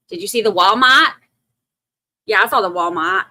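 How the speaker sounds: put-on licht Boston-accent nagedaan. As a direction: imiteer het Boston-accent van de advocaten. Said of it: "he also has the best accent".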